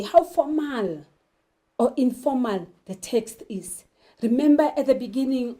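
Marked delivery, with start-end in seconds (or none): none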